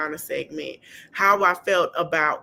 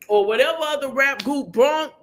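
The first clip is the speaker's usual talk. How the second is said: usual speaking voice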